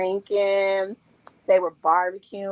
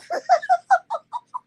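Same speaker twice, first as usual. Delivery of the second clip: loud high-pitched cackle; 4 dB louder than usual. Real, not acted. Storyteller cracking up at her own story with a high laugh. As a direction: burst into a shrill laugh.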